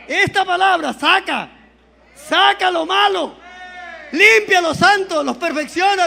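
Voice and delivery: loud, rising preacher's call to the congregation; full-force preaching. Acted. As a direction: preach it out with fire, pushing each phrase.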